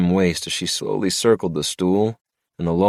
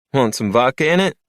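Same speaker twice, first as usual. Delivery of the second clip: higher, louder female bartender voice; April cutting in, high and bright-voiced; 9 semitones higher, 4 dB louder than usual. A narrator's reading.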